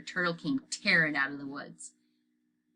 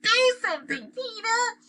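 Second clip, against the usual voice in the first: high, louder pinched-nose yell; 5 dB louder than usual. A narrator's reading. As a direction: shout at Peter for help. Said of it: "Sheila yelled".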